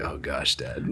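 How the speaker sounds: low, quiet mock groan of dismay; embarrassed kid. Older kids reacting to dad's jokes, quietly exasperated. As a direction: mutter it under your breath, pitch dropped.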